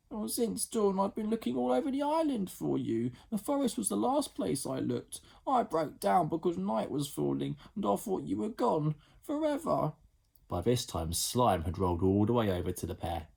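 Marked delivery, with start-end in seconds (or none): 0.1-9.9 put-on higher girl's voice, apologetic; a big sister saying sorry; sorry older sister Jemima.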